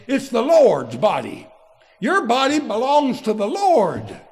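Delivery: loud, full-force pulpit preaching; fiery preaching. Acted. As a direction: thunder the verses at the congregation.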